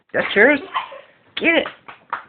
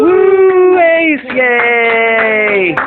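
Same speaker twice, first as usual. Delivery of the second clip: cheering; 11 semitones higher, 4 dB louder, much slower than usual. Real, not acted. Cheering after birthday song.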